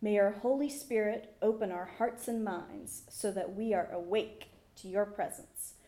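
ordinary talk

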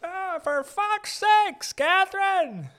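very high, loud, drawn-out moan; hammed-up complainer screeching at someone; exasperated resident. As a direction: wail it slowly in a put-on high voice. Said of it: "There's gotta be a guy like that".

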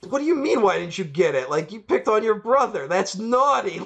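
high, scolding mock Santa; indignant Santa. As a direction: play Santa lecturing a grown-up like a child. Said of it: "And he was like".

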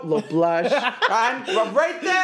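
high, slow, unhurried humming; primping diva. Pretend. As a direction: take your time touching up makeup. Acting drag queen primping slowly.

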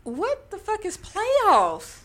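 high, slow disbelief; incredulous. Real, not acted. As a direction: ask in utter disbelief. Incredulous at schedule.

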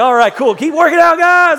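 high, loud call to the next room; cheering call. Retold or imitated. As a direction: cheer them on from far away. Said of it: "I was like".

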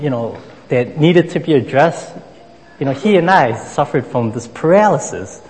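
usual speaking voice

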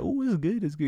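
high, mock-delighted "ooh, it's good"; mock girly delight. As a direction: ladies tasting the drink, mock delight. Ladies tasting, acted quote.

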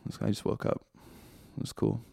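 breathy whisper to himself; whispering about his own just-woke-up voice; whispered aside. Real, not acted. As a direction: mutter the joke under your breath.

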